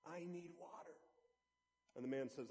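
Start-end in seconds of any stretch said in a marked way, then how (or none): 0.1-0.9 faint, dry croak of a man dying of thirst; parched voice. Retold or imitated. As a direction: rasp it out softly, barely any voice left. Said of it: "in a parched voice says".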